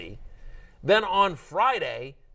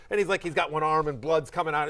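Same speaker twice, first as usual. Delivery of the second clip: normal talking voice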